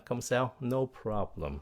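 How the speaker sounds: ordinary talk, nothing marked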